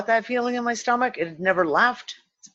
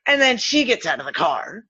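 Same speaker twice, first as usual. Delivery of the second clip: normal talking voice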